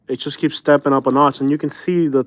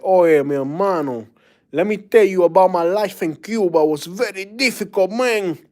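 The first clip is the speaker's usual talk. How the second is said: hammed-up Cuban accent, rising higher; 4 semitones higher than usual. Imitating Cubans one meets, in a Cuban accent. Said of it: "they don't talk like that".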